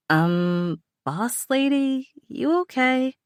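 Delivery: high, lightly amused friend's voice; a high, playful coworker checking in; amused London. A narrator's reading. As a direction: tease her boss with a grin in the voice. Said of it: "she asked with apparent humor in her voice".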